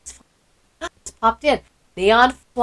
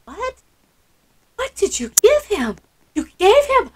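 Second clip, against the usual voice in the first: surprised; 8 semitones higher than usual. Real, not acted. Reacting to surprise gift.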